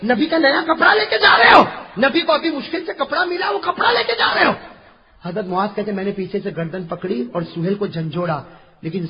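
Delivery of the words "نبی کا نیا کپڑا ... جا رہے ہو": scolding shout. Retold or imitated. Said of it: "کپڑا پکڑا اور کہا".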